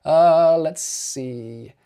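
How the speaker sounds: ordinary talk, nothing marked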